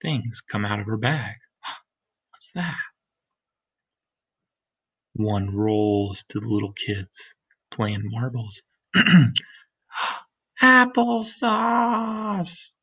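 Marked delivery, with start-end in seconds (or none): 1.6-2.8 gasp, then a whispered question. Acted. Reader reacts to falling cans. 10.6-12.7 high, slow, drawn-out amazement; amazed. Acted. Lina amazed at rare food.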